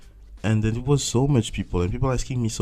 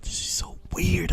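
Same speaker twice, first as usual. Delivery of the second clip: breathy whisper, pitched up; whispered disbelief; 10 semitones higher than usual. Retold or imitated. Overwhelmed by speaking English, whispering. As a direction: whisper it like a secret shock.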